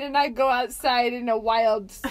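normal talking voice

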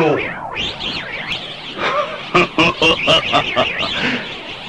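very high mocking laugh rising up; high rising triumphant cackle; shrill villain laugh.

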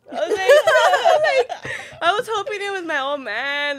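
high, loud, cracking up mid-sentence; laughing hard. Real, not acted. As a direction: burst out laughing while telling it. Cracking up mid-story about hoped-for caller.

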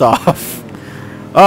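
ordinary talk, nothing marked

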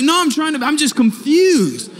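a high, loud, flustered excuse to the teacher; flustered protest. Retold or imitated. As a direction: defend yourself, caught off guard. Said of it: "I was like".

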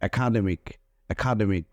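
একাডেমিক with a clear k sound at the end; final c as k. Shown as a demonstration. Teacher showing students the final c as k, clearly. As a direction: say একাডেমিক ending on a crisp খ. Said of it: "সি এর সাউন্ডটা খ এর মতো হয়".

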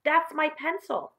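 high-pitched schoolboy explaining himself; lightly voiced earnest pupil; trying to explain. A narrator's reading. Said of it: "John tried to explain".

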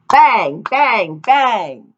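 "Bang, bang, bang" as tin-can noise. A narrator's reading. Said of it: "make terrible noises".

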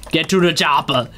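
hammed-up Austrian action-hero accent; Arnold Schwarzenegger. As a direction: bark movie catchphrases as an Austrian strongman. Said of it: "that's my Arnold Schwarzenegger impression".

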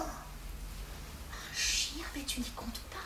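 horrified hushed reply; scandalised husband whispering to his wife; appalled whisper. Acted. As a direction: whisper it in disbelief.

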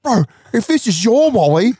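high, building to a stretched-out wail; hammed-up dawning horror ending in a squeal; mock horrified realisation. Friend realising his own wallet is gone, horrified.

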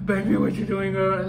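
mock a creepy guy hitting on her. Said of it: "Like, not like that".

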